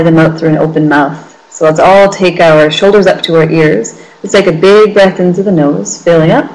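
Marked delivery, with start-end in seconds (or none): none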